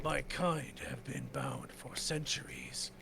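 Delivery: slow voice of an ancient stone being; a lightly voiced earth spirit, slow; slow earth guardian. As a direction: let each word settle like stone. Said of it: "heavily, uh, Terran accent".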